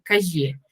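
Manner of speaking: calle with the ll voiced like y, kaye; Lima pronunciation. Shown as a demonstration. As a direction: calle said Lima style, y sound for ll. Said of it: "cuando dicen en Lima". (calle al estilo limeño, la ll como ye)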